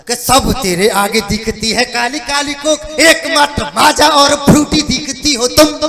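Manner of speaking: loud declaimed poetry rising to the punchline; verse recitation. Acted. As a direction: build the verse to a big finish. Recites his comic verse. (ज़ोरदार कविता पाठ)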